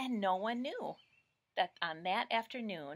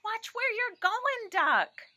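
much higher, sharp hen scold; a fussy chicken telling him off; 8 dB louder, slower than usual. Acted. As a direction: hen warning the show-off duck. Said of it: "But what she thought was".

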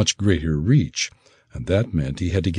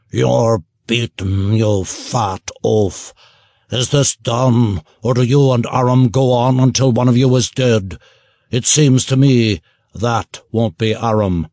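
contemptuous gnoll; 4 semitones higher, 4 dB louder than usual. Acted. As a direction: sneer down at the beaten ogre. Gnoll scorns ogre.

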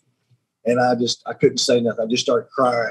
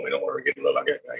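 mock worried thought; 10 semitones lower, 8 dB quieter, much faster than usual. Imitating new dad's inner fear.

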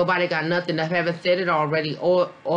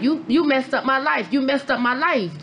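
accusing son; 8 semitones higher than usual. Retold or imitated. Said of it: "he was like".

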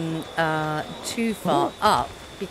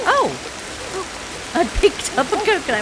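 surprised; 8 semitones higher, 4 dB louder, faster than usual. Real, not acted. Surprised find in game.

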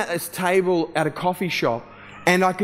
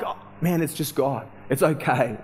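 soft, low reassurance; gently soothing, retold; settling him; 4 semitones lower, 4 dB quieter than usual. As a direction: drop lower and quieter to calm someone. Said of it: "I had to just settle him there".

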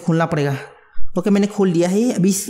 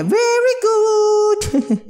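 very high, drawn-out sing-song praise; gleeful stretch; much slower than usual. Real, not acted. Gamer cheering as the power turns on, high drawn-out sing-song.

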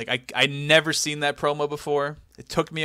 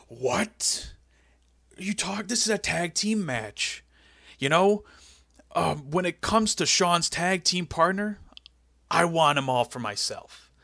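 higher-pitched mock wrestling boss; Vince McMahon; 4 semitones higher than usual. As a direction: play an indignant boss in a lightly put-on voice. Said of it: "And Vince goes".

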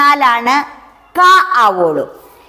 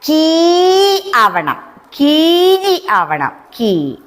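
കീ ആവണം drawn out very slowly and higher; slow longing; 3 semitones higher, much slower than usual. Shown as a demonstration. Teacher voicing the letter's slow longing. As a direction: stretch കീ long as a wish. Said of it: "വീണ്ടും കായ്ക്ക് മോഹം".

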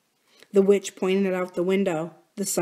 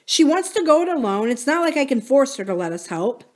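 higher-pitched exasperated explanation; 6 semitones higher, 4 dB louder than usual. A narrator's reading. Buki explains to his brother about Corinne, exasperated. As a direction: argue that it's out of your hands. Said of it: "sounding exasperated".

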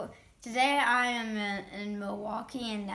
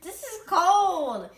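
surprised; 8 semitones higher, 9 dB louder, slower than usual. Real, not acted. First bite of cold cheese.

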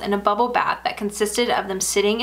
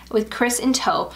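ordinary delivery

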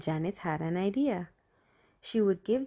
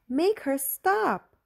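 much higher, louder, slow wailing plea; frantic cry; 10 semitones higher, 6 dB louder, much slower than usual. A narrator's reading. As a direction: build to a desperate cry for mom. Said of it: "Julie went crazy".

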